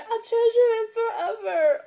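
high, teary and choked up; on the verge of tears. Real, not acted. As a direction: fight back the tears. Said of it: "Okay, I'm not gonna cry".

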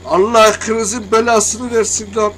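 real gamer meltdown; furious slow curse. Real, not acted. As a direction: drag the curse out in rage. Cursing after crash.

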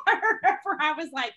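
3 laugh the words out; 4 real laughter breaking into the line; 1 laughing while speaking. Real, not acted. Laughing while recalling the scene.

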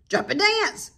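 high rooster call; high crowing rooster. A narrator's reading. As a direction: crow it like a rooster. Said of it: "said the rooster".

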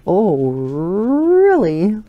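slow, high, loud sassy "really"; emphatic sass. Retold or imitated. Little girl challenging you with hand on hip, slow sass. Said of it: "emphatically states".

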